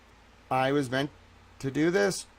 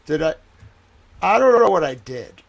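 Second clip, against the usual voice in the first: distraught outcry; 2 semitones higher, 10 dB louder than usual. Real, not acted. Distraught outburst after confused rambling.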